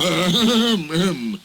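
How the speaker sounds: a stifled laugh turned into ahem; throat-clearing cough. Acted. The fat controller covering his laughter with an ahem. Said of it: "the fat controller coughed".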